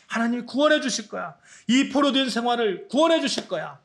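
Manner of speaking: high, loud, swelling proclamation of the promised land; fervent promise. Acted. Father promising his son a homeland, fervent and rising. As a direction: preach it like a father vowing return. Preacher voices ancestors' promise.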